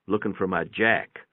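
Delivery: ordinary delivery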